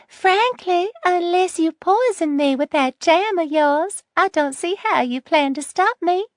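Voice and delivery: put-on high syrupy old lady voice; sweetly menacing grandma. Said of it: "the syrup back in her voice".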